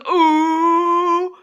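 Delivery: teasing ooh. Retold or imitated. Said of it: "Jude down the hall goes".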